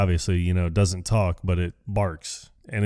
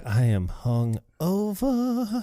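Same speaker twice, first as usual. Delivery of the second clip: slow, high, drawn-out hungover groan; hungover Brian; 10 semitones higher, 4 dB quieter, much slower than usual. Retold or imitated. Friend quoting Brian pouring coffee, slow and groggy. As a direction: drag the words out like a hangover. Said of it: "he's like".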